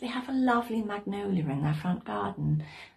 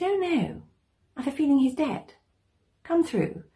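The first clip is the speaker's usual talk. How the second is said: higher-pitched, matter-of-fact neighbour; lightly voiced neighbour; 7 semitones higher, 4 dB louder than usual. A narrator's reading. Neighbour calmly reports a death.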